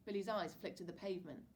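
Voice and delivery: ordinary delivery, nothing marked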